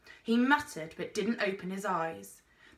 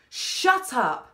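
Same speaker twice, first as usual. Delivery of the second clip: loud angry outburst; shouted fury; furious; 5 semitones higher, 8 dB louder than usual. A narrator's reading. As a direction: cut him off in rage. Girl snaps at the explorer.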